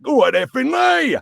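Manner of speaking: high, loud, drawn-out cartoon voice; Suds. Shown as a demonstration. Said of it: "He was more like that".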